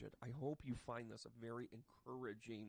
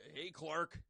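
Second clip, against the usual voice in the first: lazy neighbour call; 4 semitones higher, 4 dB louder than usual. Retold or imitated. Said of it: "brother-in-law on your front lawn going".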